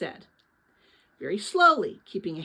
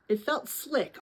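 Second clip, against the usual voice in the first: ordinary delivery, nothing marked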